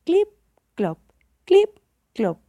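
short, spaced clip-clops; donkey hoofbeats. A narrator's reading. The little donkey walks along, imitated hoofbeats. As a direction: voice each hoof step separately, clip up, clop down. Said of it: "se oían los pasos".

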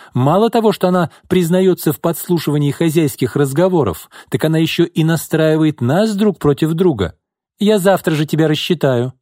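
high, indignant tirade; crying out. A narrator's reading. Mistress threatens to fire the servant, angrily crying out. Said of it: "крикнула она".

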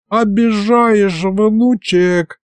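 put-on slow, high, wounded old-man whine; hurt old man. A narrator's reading. Grandfather reproaches his grandson, tearfully hurt. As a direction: draw it out high, tearfully offended. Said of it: "пустил скупую слезу дед".

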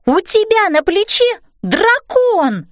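put-on high, slow astonished child's cry; exclaiming. A narrator's reading. Girl warning mum of the dragon, exclaiming. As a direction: sound the alarm high, stretching the words. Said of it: "воскликнула я".

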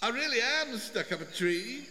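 high, soft, distant little voice; small high-pitched voice calling down; Wilbur. A narrator's reading. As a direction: insist thinly from far above. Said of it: "said Wilbur's voice".

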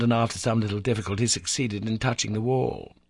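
ordinary delivery

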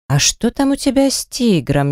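put-on barely manage the words, mildly drunk; drunkenly slurred. A narrator's reading. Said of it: "еле ворочала языком Ленка". (еле ворочая языком, пьяно)

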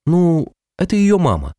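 выше и чужим тембром; притворяется матерью по телефону; изменённый голос. Acted. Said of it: "Эмма заговорила изменённым голосом". (изменённый голос)

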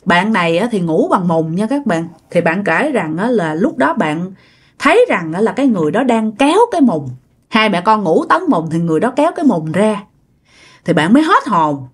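ordinary delivery, nothing marked

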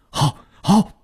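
high, quick shouts of agreement; urgent assent. A narrator's reading. Household agreeing to search the villa, urgent assent. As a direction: answer the order at once, pitched high.

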